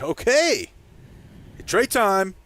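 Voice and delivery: loud, high hype shout; imitated fan excitement. Imitating fans when Trey Lance gets in, excited loud cheer. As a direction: holler it like a thrilled fan. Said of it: "and they're like".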